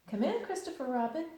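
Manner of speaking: lightly voiced sleepy bear; Pooh voice. Pooh inviting the noise at his door.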